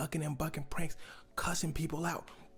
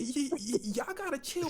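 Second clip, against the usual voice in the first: much higher, stammering plea; mock nervous stutter; 10 semitones higher than usual. Streamer backing down from party guests, squeaky nervous stammer. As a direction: squeak it out scared. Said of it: "Jack said".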